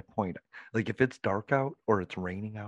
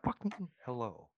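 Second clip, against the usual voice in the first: mock Kentucky hello; 3 semitones higher, 9 dB quieter than usual. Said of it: "It's a Kentucky hello".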